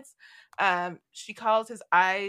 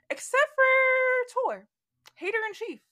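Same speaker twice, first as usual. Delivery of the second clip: mock outrage; 4 dB louder than usual. Telling of Tor refusing to kneel, mock outrage. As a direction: stretch it out in disbelief.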